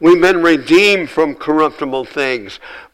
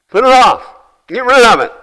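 loud, high-pitched exhortation; forceful command; 10 semitones higher, 7 dB louder, faster than usual. Real, not acted. Preacher orders believers to rid themselves of filthy talk, forceful command. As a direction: burst out with an urgent order.

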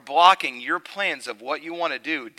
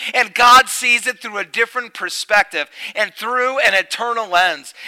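much louder and higher fervent sermon voice; full-force preaching; 8 semitones higher, 10 dB louder than usual. Acted. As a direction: preach it out to the whole room.

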